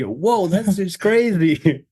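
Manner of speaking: high, slow, amazed whoa. Pretend. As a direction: stretch it out like a wowed robot judge. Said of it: "It's like".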